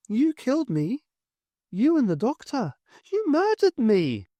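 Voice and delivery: put-on a murdered child naming her killer; accusing ghost girl. A narrator's reading.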